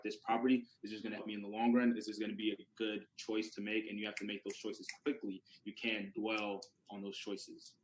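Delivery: ordinary talk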